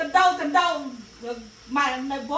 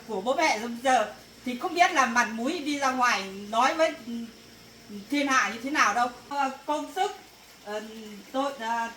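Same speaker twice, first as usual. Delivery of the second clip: ordinary talk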